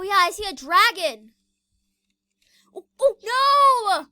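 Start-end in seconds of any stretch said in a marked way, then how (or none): 2.7-4.1 slow, loud, high cry of alarm; panicked cry. Real, not acted. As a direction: wail it out in panic. Sees danger in game.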